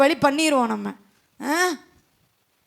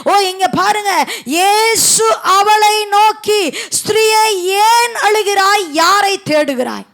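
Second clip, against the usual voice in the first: raised, sustained preacher's voice; forceful preaching; 4 semitones higher, 5 dB louder than usual. Real, not acted. Preacher warning the congregation about false voices, forceful preaching. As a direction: preach a ringing warning, drawing out the scripture.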